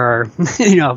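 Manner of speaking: ordinary talk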